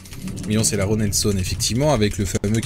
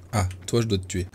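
ordinary delivery

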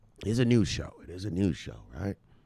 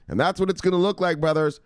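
normal talking voice

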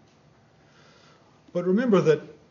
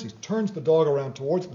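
ordinary talk, nothing marked

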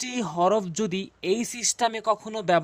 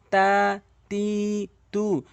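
drawn-out vowels; much slower than usual. Shown as a demonstration. Teacher demonstrating long vowels to viewers, slow and stretched. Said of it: "টেনে টেনে পড়তে হবে".

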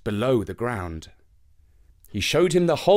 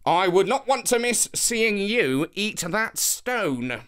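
slow, higher, smug; arrogant; 7 semitones higher, slower than usual. A narrator's reading. Anansi taunting the old lady, smug and arrogant. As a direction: savour each word smugly. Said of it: "the arrogant Anansi replied".

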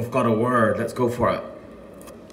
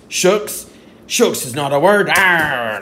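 mock indignant pirate; 5 semitones higher, 7 dB louder than usual. Pirate rejects silly word.

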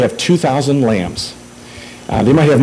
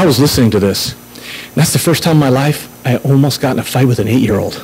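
ordinary delivery

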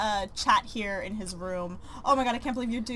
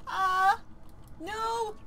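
shocked cry; 10 semitones higher than usual. Real, not acted. Shocked by game event.

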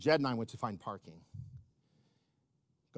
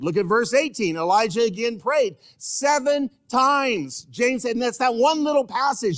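raised pitch, loud, insistent sermon delivery; full-force preaching; 8 semitones higher, 9 dB louder than usual. Real, not acted. Preacher urging the church to pray, insistent full-force preaching. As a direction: hammer the key word home like a preacher. Preacher drives home the text.

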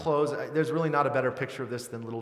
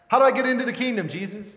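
high, loud, rushed mock question; imitated eager questioner; 9 semitones higher, 8 dB louder, faster than usual. Man asking Jesus about eternal life, mock-eager. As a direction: blurt it out as the imitated seeker.

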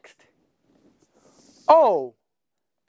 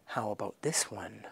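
whisper; 7 semitones lower, 17 dB quieter than usual. Real, not acted. Teacher quiz aside.

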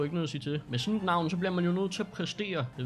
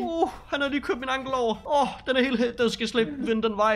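high, loud pained talk; acted pain; 8 semitones higher, 5 dB louder than usual. Tells the officer about his ankle, pained and loud. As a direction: whine through the hurt ankle.

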